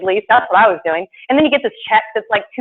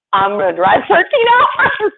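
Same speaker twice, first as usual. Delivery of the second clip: laughing; 5 semitones higher, 4 dB louder, slower than usual. Real, not acted. Laughing excited retelling.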